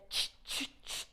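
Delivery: unvoiced ch ch ch; monkey chatter. A narrator's reading. Monkeys mock the peddler, soft unvoiced chatter. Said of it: "monkeys ... said".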